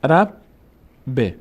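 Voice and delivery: رب, pause, then ب as "be"; two separate beats for رب، ب; split syllables. Shown as a demonstration. Teacher showing tashdid with kasre, syllables apart. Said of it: "حرف دوم کسره داشته".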